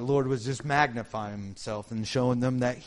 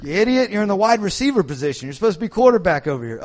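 higher, louder and fast, a scolding correction; exasperated coach; 6 semitones higher, 7 dB louder, faster than usual. Retold or imitated. The coach corrects a misplaced player, loud and fast. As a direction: tell off a player in the wrong spot. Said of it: "he's going".

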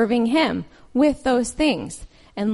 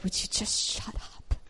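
whispered, fed-up plea to your own mind; pleading with the nagging inner voice, whispered; exasperated inner voice; 11 dB quieter than usual. Retold or imitated. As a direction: breathe it out in frustration. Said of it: "and you're like".

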